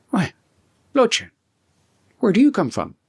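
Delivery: a friend caught off guard, voice jumping up; startled surprise. Acted. Friend startled at midnight door.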